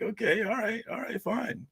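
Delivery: high, soft placating voice; quiet high-pitched "fine, whatever" reply; mock acquiescence. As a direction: give in with a small, high-pitched voice. Acting out his resigned reply.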